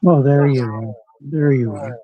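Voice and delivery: usual speaking voice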